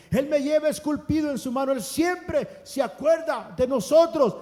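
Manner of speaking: shouted, climactic preaching; full-throated climax, slowing on the last line; preaching at full force. Acted. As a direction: roar the certainty of faith.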